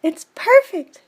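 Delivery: put-on a sweet young fish princess; Juliet's voice. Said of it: "she said".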